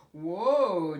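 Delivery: loud amazed whoa. A narrator's reading. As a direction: gasp at the big fish. Jimmy amazed.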